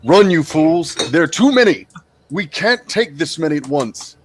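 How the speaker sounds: acted urgent shout; shouted warning. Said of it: "So I shout to the party".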